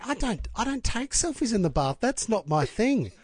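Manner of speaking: mildly indignant retelling of a refusal; protesting refusal. Retold or imitated. Retells refusing colleague's bath selfie request.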